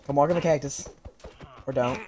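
normal talking voice

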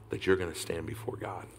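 ordinary delivery

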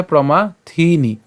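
প্রমাথিনি with a long, stressed থি; slow down and lean on থি in প্রমাথিনি; drawn-out থি in প্রমাথিনি; stress on THI. Shown as a demonstration. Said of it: "একটু জোর দিতে হবে".

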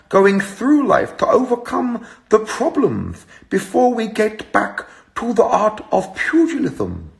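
Chris Eubank impression. Eubank impression cheering people up, slow grand sermon. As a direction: preach the warrior's code like Eubank. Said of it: "Eubank impersonation".

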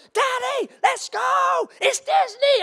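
high-pitched mimicked kids' voice; excited little boys. Excited sons waking dad for Disney, high kid voice. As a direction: squeal it like a child pestering daddy. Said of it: "the boys were excited now".

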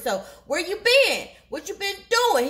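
mock jealous grilling; accusing. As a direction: demand answers like a suspicious lover. Boyfriend confronting her in retold quarrel.

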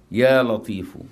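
invocation. Shown as a demonstration. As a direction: raise the voice in supplication. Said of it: "resumes invocation".

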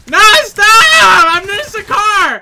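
loud, high drawn-out wail; pleading shout. Real, not acted. Disguised player begging hunters to stop, pleading shout. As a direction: holler in desperate protest.

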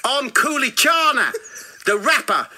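put-on loud, high boastful rapper with South African accent; indignant South African accent, shouted high. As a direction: declare it furiously in hammed-up South African. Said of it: "I'll stop the accent".

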